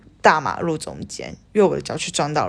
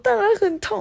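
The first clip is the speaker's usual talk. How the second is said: high sarcastic retort. Retold or imitated. Sarcastic retort to old man.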